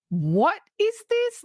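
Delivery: high, slow what is this; bewildered. Retold or imitated. Host retelling watching the movie, slow bewildered question. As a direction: stretch it out in confusion.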